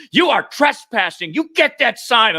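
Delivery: an angry neighbor ordering a volunteer off her yard; retold yelling. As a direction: scold him off the lawn. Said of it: "she's still yelling at me".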